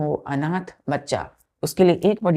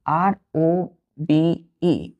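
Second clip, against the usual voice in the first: slow spelling out; slower than usual. Shown as a demonstration. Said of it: "हमने बोला बच्चों wardrobe".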